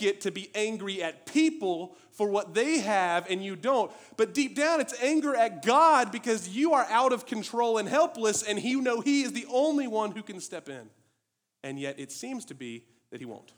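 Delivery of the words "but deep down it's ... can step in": high, loud impassioned preaching; pressing the point hard on the congregation; preaching at full force. Real, not acted. Pastor to church, loud impassioned preaching.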